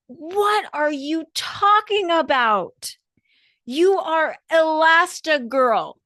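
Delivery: high, loud, very slow put-on voice; Edna impression. Costumer Edna snapping Elastigirl out of it, slow, high, loud diva voice. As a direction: drag it out in a big, theatrical diva voice. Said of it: "And Edna says".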